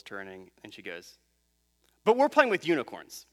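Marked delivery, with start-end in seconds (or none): 2.0-3.3 put-on higher, louder little-kid objection; imitated child's protest. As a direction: retort like an indignant small daughter. Small daughter's punchline protest, retold.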